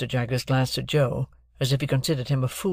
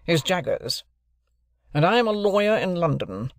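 raised, deliberate lawyer's voice; stern lawyer; 3 semitones higher than usual. Acted. Jaggers introducing himself to Joe and Pip, stern lawyer. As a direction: announce yourself like a cool London lawyer.

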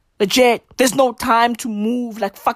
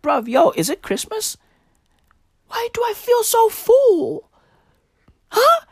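confused surprise; 13 semitones higher than usual. Retold or imitated. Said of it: "I be out there like".

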